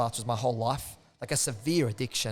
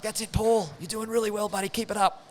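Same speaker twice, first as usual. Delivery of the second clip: upbeat coach cheer; 8 semitones higher than usual. Retold or imitated. Coach cheering on his Zoom class, higher upbeat encouragement. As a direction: pump up the class like a keen instructor.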